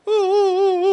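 high, loud imitation of women wailing "ooh"; mock wailing. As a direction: wail the ooh's like the weeping women, pitched high. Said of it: "When those women were crying".

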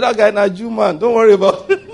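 high, laughing amused voice; chuckling as he waves it off; God amused. Acted. Preacher voicing God amused, laughing it off. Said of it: "He said".

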